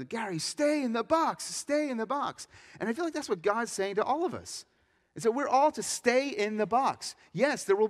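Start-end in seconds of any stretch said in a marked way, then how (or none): 0.0-2.5 high, slow, drawn-out call; mock coach's yell, stretched out; imitated coach yelling. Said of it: "my coach just yelling".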